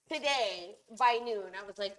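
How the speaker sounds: ordinary talk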